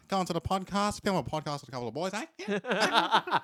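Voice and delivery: put-on Australian farmer yarning to Brandon about the boys. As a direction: play a laid-back outback farmer chatting. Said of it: "test for [name]'s farmer voice".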